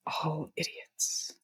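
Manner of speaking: slow whispered grumble; muttered contempt. Acted. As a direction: grumble it voicelessly under your breath. Character grumbles about teammates.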